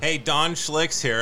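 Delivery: normal talking voice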